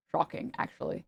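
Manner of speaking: whispered, sarcastic "Shocking"; voiceless, sarcastic "Shocking"; mock whisper. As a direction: breathe "Shocking" as a hushed aside.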